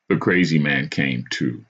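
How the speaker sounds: ordinary delivery, nothing marked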